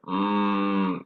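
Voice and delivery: low relaxed hum; demo humming. Shown as a demonstration. Voice coach showing the humming exercise. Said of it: "it's humming".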